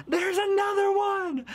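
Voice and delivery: high, drawn-out scream of the words; shrieking in panic at the bug; terrified shout. Retold or imitated. Sees second cockroach.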